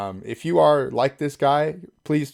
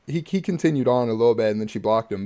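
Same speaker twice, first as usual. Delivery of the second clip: ordinary delivery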